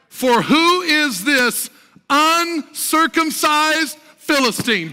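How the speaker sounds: slow, high, punched-out verse; declaimed scripture reading. Real, not acted. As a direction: hammer out each word from the pulpit.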